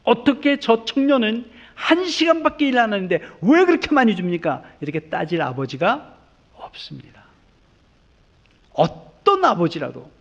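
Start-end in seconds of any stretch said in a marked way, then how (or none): 0.0-4.6 protesting father. Pretend. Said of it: "아버지가 손을 들고". 4.8-7.2 hushed aside after the outburst; whispered pulpit aside. Acted. Preacher drops to a whisper.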